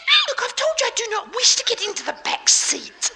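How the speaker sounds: put-on high prim young woman; girl's voice. Girl refuses the back seat again in a high voice. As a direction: insist primly in a tiny high voice. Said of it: "She said".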